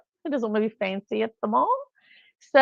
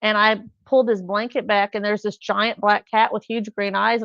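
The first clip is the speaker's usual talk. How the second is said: ordinary talk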